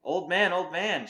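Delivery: put-on loud, high call to the old man; boy shouting for help. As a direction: call out across the yard. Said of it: "shouted Chang".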